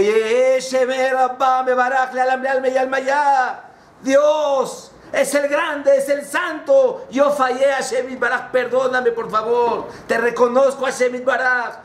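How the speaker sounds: high, imploring proclamation; acted penitent plea; fervent prayer. Sinner fervently prays at synagogue.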